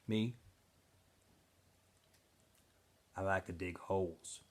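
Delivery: put-on low, soft, sullen mutter of a silent boy; Zero's sullen answer. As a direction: answer like you resent being asked. Zero glaring, answers flatly.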